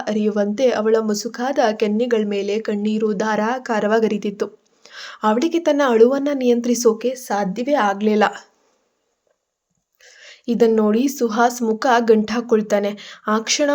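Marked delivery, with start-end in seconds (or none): none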